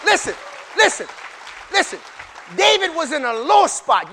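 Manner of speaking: loud, high shouted preaching; full-force preaching. Acted. Preacher shouting over the congregation, full force. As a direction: whip the church up, shouting.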